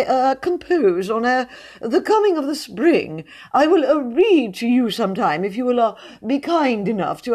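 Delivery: pompous poet, pitched well up; a fussy, self-important high voice, full of ums. A narrator's reading. Mr Jones reads his spring poem to admirers, high-pitched and pompous. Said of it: "in his high-pitched voice".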